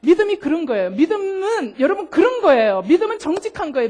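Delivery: usual speaking voice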